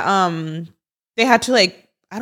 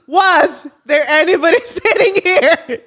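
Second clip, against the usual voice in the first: repeating it through laughter; laughing; 6 semitones higher, slower than usual. Retold or imitated. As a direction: laugh while you say it, slower. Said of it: "I'm laughing because I have actually major fear of planes".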